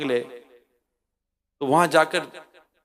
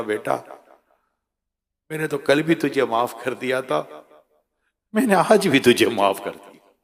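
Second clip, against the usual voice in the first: lower, gentle forgiving mother's voice; tender mother; 4 semitones lower than usual. Retold or imitated. Mother forgives her son.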